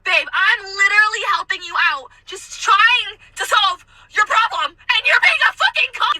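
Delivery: high, rising mock tirade; exasperated outburst. Server helping rude customer find a table, exasperated. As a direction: build from exasperation to a squealed insult.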